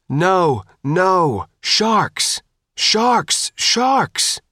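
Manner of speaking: high, urgent repeated shouts; shouted warning. A narrator's reading. Sophia warns the climber over the loudhailer, shouting. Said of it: "Sophia shouted over the loudhailer".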